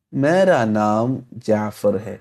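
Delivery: very slow, careful dictation; slow model reading. Shown as a demonstration. Teacher to students, very slow model sentence. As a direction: say it slowly so students follow. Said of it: "teacher models Urdu sentence".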